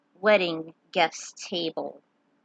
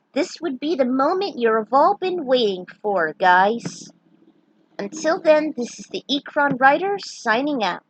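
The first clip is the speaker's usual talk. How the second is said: excited; 5 semitones higher, 6 dB louder than usual. Real, not acted. Excited sign-off to viewers.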